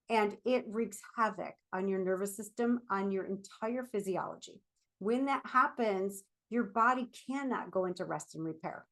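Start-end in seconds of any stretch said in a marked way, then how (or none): none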